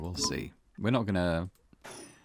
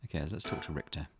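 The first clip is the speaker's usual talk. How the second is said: ordinary talk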